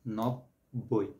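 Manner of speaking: নব্বই stretched very slowly, both ব sounds clearly heard; drawn-out teaching demo of নব্বই, each ব held; slow demo pronunciation. Shown as a demonstration. Teacher slowly models নব্বই for students, drawn out. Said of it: "এটা যখন আমরা উচ্চারণ করি".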